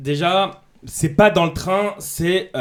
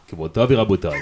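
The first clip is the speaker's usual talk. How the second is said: Israeli airline pilot; 7 semitones lower, faster than usual. Retold or imitated. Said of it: "tu peux nous faire le pilote d'avion israélien".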